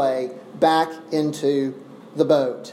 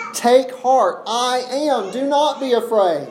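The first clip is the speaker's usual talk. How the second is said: higher, pressing exhortation; emphatic proclamation; 5 semitones higher than usual. Acted. Jesus to all the disciples, emphatically proclaiming courage. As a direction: proclaim it in one driving run.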